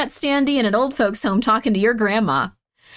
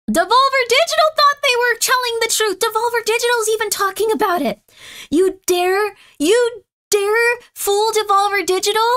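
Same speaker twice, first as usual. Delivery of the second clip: mock outrage; 9 semitones higher than usual. Streamer hamming up outrage for Devolver Digital. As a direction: ham up the indignation.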